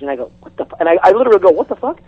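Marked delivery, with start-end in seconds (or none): 0.4-0.8 hushed, cut-off curse; under-the-breath baffled reaction; muttered disbelief. Retold or imitated. First, cut-off retelling of his reaction. 1.5-2.0 high-pitched bewildered question; mock bewildered curse; baffled disbelief. Repeats his baffled reaction, completed.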